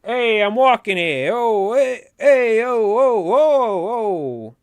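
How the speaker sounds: loud, high, drawn-out New York holler; hammed-up Italian-American New York wiseguy; Sopranos New York accent. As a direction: bellow like a New York mobster. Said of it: "I think he would say crowns".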